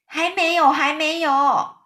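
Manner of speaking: high, loud, stretched cry; calling back. A narrator's reading. A creature answers the spider's cry, calling out. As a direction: shout back that it's not time. Answering cries as room tilts.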